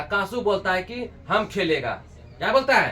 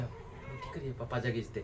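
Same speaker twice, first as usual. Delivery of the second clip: scared hush; 7 semitones lower, 14 dB quieter than usual. Retold or imitated. Scared boy thinks aloud.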